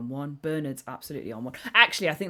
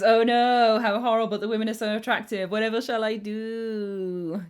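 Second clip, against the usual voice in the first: hammed-up distressed lady, louder and higher; mock damsel; 5 semitones higher, 8 dB louder than usual. Streamer reads chat's joke as a hammed-up damsel. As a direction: play a fainting damsel overwhelmed by beauty. Reading a chat joke dramatically.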